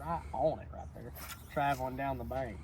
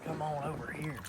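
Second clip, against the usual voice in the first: ordinary talk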